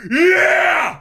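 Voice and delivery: loud high triumphant yell; mock cheer. Friend acting out the cheering camper, loud yell. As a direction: shout it like a victory cry. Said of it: "And you're like".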